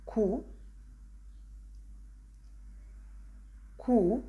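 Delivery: ku as hard k plus long oo; Italian sound demo. Shown as a demonstration. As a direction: say ku as one isolated syllable, "koo". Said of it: "the last sound is".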